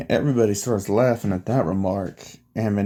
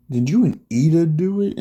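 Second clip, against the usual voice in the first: higher-pitched nosy question; shocked suspicion; 6 semitones higher, 4 dB louder than usual. Retold or imitated. Classmates put two and two together about the friends, shocked suspicion. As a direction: act out a startled, prying question.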